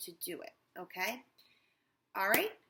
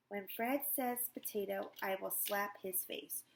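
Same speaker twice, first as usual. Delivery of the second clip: slow trance repeat; slower than usual. Acted. Hypnotized woman repeating.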